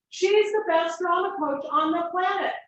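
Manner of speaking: put-on high, loud, offended teenage voice; indignant girl. Girl to teacher who doesn't know her coach, high indignant outrage. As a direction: act baffled and insulted at the question. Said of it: "She looked at me like she's gonna end my life".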